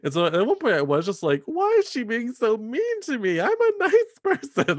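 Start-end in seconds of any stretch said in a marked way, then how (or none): 1.4-4.8 high, hurt, wide-eyed innocent voice; naive Pollyanna. Retold or imitated. Her younger self whining about the mean boss, high and naive. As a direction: play her younger naive self, much higher and louder. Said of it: "such a Pollyanna about it".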